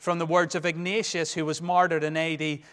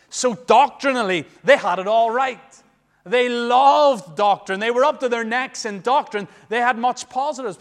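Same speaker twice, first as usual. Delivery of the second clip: louder and higher, slowing on doctrine; raised preaching voice; emphatic preaching; 5 semitones higher, 8 dB louder than usual. Real, not acted. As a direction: press the point home to the congregation. Preacher emphatic praise of church.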